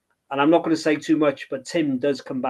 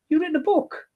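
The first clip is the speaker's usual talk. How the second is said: much higher, curious voice; local man asking; 13 semitones higher than usual. Retold or imitated. A villager asking about the book, high curious query. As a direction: pop the question up high, like a bloke at the pub. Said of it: "he goes".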